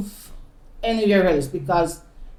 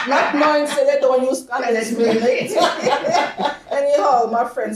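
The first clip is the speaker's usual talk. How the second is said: higher, laughing through the words; 5 semitones higher than usual. Real, not acted. Recalling the condensed milk tea, laughing. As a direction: crack up at the memory.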